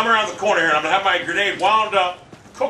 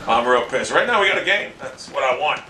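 ordinary talk, nothing marked

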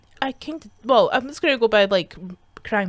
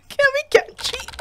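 laughing; 6 dB louder than usual. Acted. Laughing over cheating on tests.

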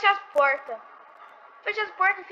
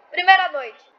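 louder, higher emphatic repeat; 4 semitones higher, 6 dB louder, slower than usual. Real, not acted. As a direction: stress it proudly.

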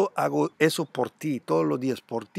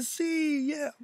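much higher, hammed-up whoop; mock cheer; 12 semitones higher, 5 dB quieter than usual. Vlogger imitating everyone cheering a rising coin, high mock whoop. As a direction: cheer like a greedy crowd. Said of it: "todo el mundo dice".

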